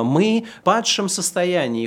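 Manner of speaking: normal talking voice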